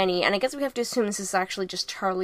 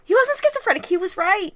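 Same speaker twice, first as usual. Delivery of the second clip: much higher, exclaimed protest; emphatic rebuttal; 11 semitones higher, 3 dB louder than usual. Real, not acted. Podcast host defends a film character's dead brother, emphatic high-pitched protest. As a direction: burst out high, insisting on it. Indignant rebuttal of the retold diagnosis.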